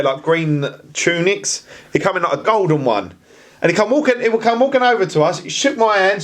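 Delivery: ordinary talk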